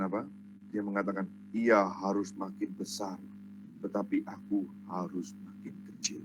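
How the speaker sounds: very low, soft solemn voice; quiet, weighty reverence; hushed humility. Acted. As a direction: drop right down to quote a humble answer. Preacher gives John's humble answer.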